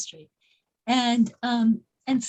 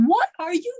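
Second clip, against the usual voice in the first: higher-pitched mock exasperation; retold fury; 7 semitones higher than usual. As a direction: retell an angry passenger losing her cool. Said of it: "she got furious. She lost her cool".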